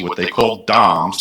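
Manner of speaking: ordinary delivery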